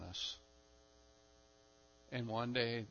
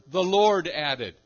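"Lord" loud, raised and lengthened; stress on Lord; 3 semitones higher, 13 dB louder, slower than usual. Shown as a demonstration. Preacher repeating subject and verb, forceful stress on "Lord". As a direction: hammer "Lord" slowly and emphatically. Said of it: "always on God or the Lord".

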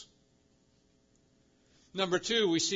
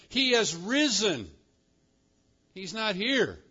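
higher, emphatic announcement; proclaiming; 5 semitones higher, 4 dB louder than usual. Acted. Angel announces the resurrection to the women, proclaiming. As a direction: announce it slowly and grandly.

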